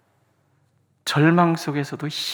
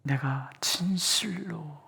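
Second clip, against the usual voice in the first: reverent whisper; 8 dB quieter, much slower than usual. Retold or imitated. Quoting Jesus reverently.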